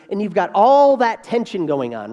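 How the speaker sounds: ordinary talk, nothing marked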